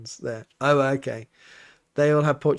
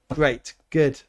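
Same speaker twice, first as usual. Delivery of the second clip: ordinary talk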